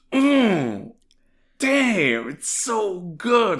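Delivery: high, loud, drawn-out delight; gushing over the line; excited. Real, not acted. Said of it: "reaction to favorite line".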